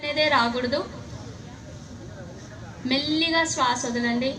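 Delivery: hushed yoga breath guidance; soft spa whisper. Acted. Guides slow breathing softly.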